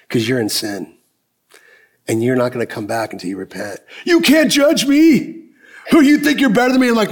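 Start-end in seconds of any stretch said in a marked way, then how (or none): 0.1-3.8 firm but quiet. Retold or imitated. Pastors firmly send her away. 4.0-6.9 indignant retort. Retold or imitated. Girlfriend indignantly snaps back at the pastors, high and stretched.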